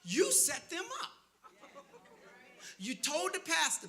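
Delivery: normal talking voice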